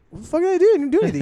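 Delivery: indignant protest. Retold or imitated. Student accused of being defiant, high-pitched retold protest. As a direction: squeal a wronged student's denial.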